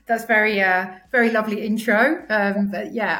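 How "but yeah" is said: usual speaking voice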